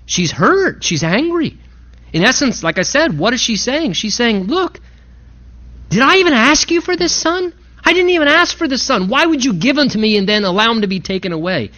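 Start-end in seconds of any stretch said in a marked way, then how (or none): 4.4-11.7 venting. Retold or imitated. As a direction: pour out her hurt at the prophet. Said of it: "she's just venting".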